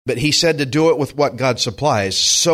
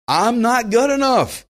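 higher, slow, half-hammed whine of self-doubt; mock self-doubt; 10 semitones higher, slower than usual. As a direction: play up the insecure pastor, drawn out. Pastor quoting his own doubt.

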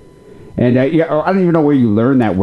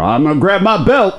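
threatening dad; 8 semitones higher than usual. Retold or imitated. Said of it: "was he like".

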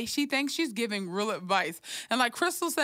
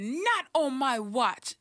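slow, stretched-out stern declaration; Iyanla; 3 semitones higher, slower than usual. Retold or imitated. Imitating Iyanla on her show, slow emphatic declaration. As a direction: draw it out like a warning. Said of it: "When she said".

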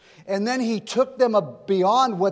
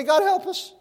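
pleading cry, pitched much higher; crying out to God; 13 semitones higher than usual. Pretend. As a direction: cry out desperately to God for help. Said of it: "cry out to God and say".

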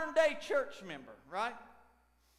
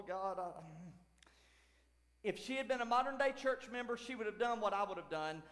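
normal talking voice

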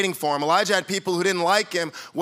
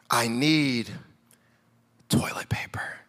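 breathy, drawn-out whisper like a drugged patient; patient whispering from bed; dopey whisper; 7 semitones lower, 5 dB quieter, slower than usual. Retold or imitated. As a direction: whisper the urgent request, half-asleep. Drugged friend after wisdom teeth.

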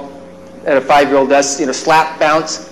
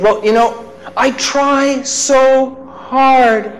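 put-on high, very slow child voice; tearful child; 9 semitones higher, much slower than usual. Son confides to dad, high slow tearful child voice. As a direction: drag it out like a sobbing boy. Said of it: "he said".

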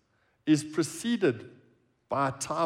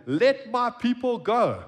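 commanding demand; 7 semitones higher, 5 dB louder, slower than usual. Acted. Moses demands Pharaoh's release.

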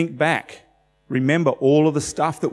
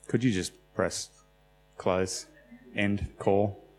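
soft, low off-mic request; hushed practical aside; quiet aside; 5 semitones lower, 8 dB quieter than usual. Real, not acted. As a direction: murmur to someone nearby. Aside to someone about phone.

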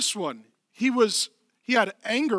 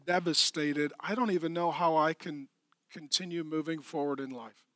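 devastated grief; 4 semitones lower, 7 dB quieter than usual. Retold or imitated. Preacher voicing a grieving listener, devastated and soft. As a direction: speak from a shattered soul. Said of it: "You're like".

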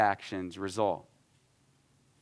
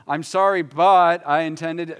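higher, louder "but I intended", slowed; defensive excuse; 4 semitones higher, 7 dB louder than usual. Shown as a demonstration. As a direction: push back with "but" while sorry. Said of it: "Don't go".

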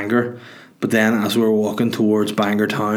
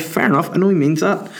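ordinary delivery

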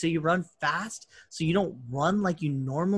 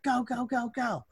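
mock urging; 8 semitones higher, 4 dB quieter than usual. Retelling a man sending a stuck car off, mock urging. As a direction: imitate someone hurrying a driver, pitched up.

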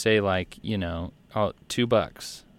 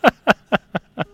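high laugh; 12 semitones higher, 9 dB louder than usual. Real, not acted. Laughs at his own joke.

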